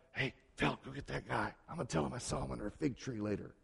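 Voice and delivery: quick, hushed conspiratorial murmur; a half-joking backstage whisper to a friend; sneaky aside. Pretend. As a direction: plot it under your breath, like a secret set-up. Jesus imagined secretly plotting with Philip.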